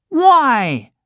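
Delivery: high, loud demanding why; puppet boy. Acted. Pinocchio demanding an answer from the cricket, high and sharp. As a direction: snap the question back.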